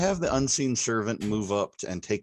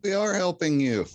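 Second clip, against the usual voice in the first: high, raised voice shouting back; calling out; 10 semitones higher, 5 dB louder than usual. Acted. Player answers the yelling goblin in the wagon, high-pitched shout. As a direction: call it out loud and high to be heard.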